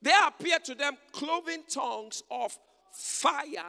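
high, loud preaching cry; preaching shout. Real, not acted. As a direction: proclaim it at full force.

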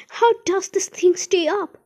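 high, loud bewildered question; anxious cry. A narrator's reading. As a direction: demand an answer in alarm. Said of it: "cried Grandpa Joe".

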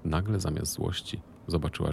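ordinary delivery, nothing marked

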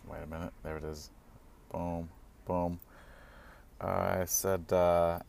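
ordinary talk

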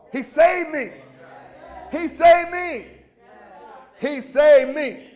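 loud, high, impassioned preacher; fired-up pulpit shout, key words stretched; full-force preaching. Real, not acted. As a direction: rouse the congregation with shouted exhortations.

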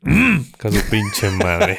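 put-on slow, low northern Mexican accent, laughing through it; norteño woman, slow and teasing. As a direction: playfully imitate a northern woman cussing. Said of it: "Una mujer con acento norteño".

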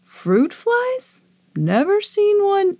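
high, swooping voice of surprise; puzzled old moon. A narrator's reading. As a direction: mishear it like a baffled old-timer. Old moon mishears again.

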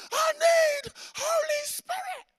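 high, slow, drawn-out holler; hammed-up toddler wail; imitated child's yelling. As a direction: mimic a little girl yelling over and over. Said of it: "keeps yelling it louder and louder".

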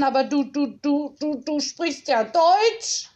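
stammering in disbelief, high and halting. A narrator's reading. Stunned headteacher stammers at the boy speaking German. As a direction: stumble over each du, stunned. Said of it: "stotterte Frau Mühlenkampf".

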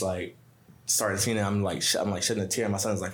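ordinary talk

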